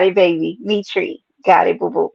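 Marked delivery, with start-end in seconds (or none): none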